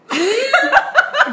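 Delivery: high burst of laughter; real high-pitched laugh. Real, not acted. Host reacts to guest about birthday cakes, high laughing burst.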